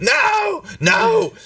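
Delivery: very high-pitched yelled protest; tantrum cry. Acted. Game master acts out Nim's kicking fit, tantrum cry. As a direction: wail it out, far above normal pitch. Acted tantrum as Nim kicks things.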